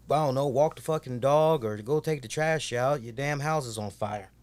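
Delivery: usual speaking voice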